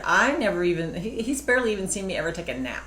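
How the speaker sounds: ordinary talk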